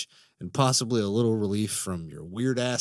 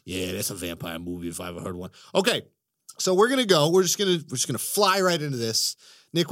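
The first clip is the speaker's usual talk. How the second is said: ordinary talk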